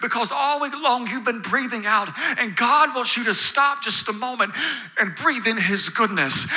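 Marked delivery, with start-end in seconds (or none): none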